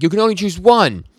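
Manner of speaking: high, loud imitation of an exasperated objection; exasperated mock shout. Yoshida retorting to Denji's two choices, exasperated mock shout. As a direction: retort loudly, pitch jumping way up.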